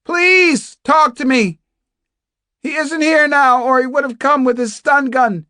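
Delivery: high, loud, desperate begging; shouted pleading. Acted. Captive begging an unseen fellow prisoner to answer, shouting. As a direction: plead at a yell for any reply.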